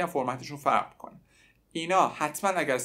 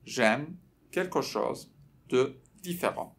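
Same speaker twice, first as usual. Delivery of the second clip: word by word, pauses between chunks; slow model phrase; slower than usual. Shown as a demonstration. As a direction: break it up slowly for learners to repeat. Teacher slows phrase for repetition.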